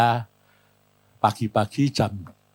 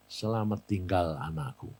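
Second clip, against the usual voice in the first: low, hushed retold goodbye; dying mother's farewell; 7 semitones lower, 10 dB quieter than usual. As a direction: softly echo a mother's parting words. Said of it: "dia bilang gini". (perpisahan ibu yang lirih dan rendah)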